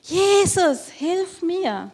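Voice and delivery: high, loud cry for help; loud scream. Acted. Beggar shouting again for Jesus to help him. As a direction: shout until your face turns red. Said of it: "weil er so laut geschrien hat".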